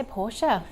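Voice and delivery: "Porsche" as POR-sha, two syllables; European-style Porsche. Shown as a demonstration. Presenter saying how Europeans say it, Porsche as POR-sha. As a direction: sound the final e of "Porsche" as "uh". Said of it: "That's what the Europeans say".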